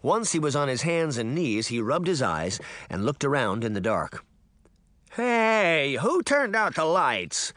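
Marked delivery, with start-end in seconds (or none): 5.1-7.5 high whiny man calling out; whiny, high-pitched. A narrator's reading. Startled deputy calling into the dark, whiny and high. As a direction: whine it high and drawn-out. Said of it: "called in a whiny, high-pitched voice".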